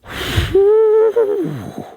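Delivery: a long sigh rising high then falling away. Real, not acted. To the viewers, picturing getting the printers, a long high sigh. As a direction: let out a big dreamy sigh, overwhelmed.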